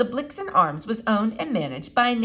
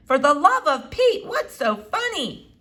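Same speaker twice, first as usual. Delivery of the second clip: exasperated; 6 semitones higher, 4 dB louder than usual. A narrator's reading. Exasperated daughter, laughing father.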